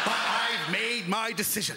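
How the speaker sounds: slow dogged insistence; mock stubborn resolve. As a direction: stick to the choice despite the danger. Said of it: "fatal nut allergy".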